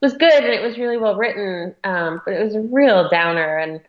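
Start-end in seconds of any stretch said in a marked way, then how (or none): none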